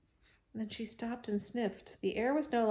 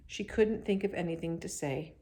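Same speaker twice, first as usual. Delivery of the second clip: ordinary delivery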